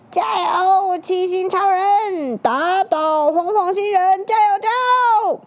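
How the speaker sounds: high, loud crowd cheer. Acted. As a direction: cheer him on loudly.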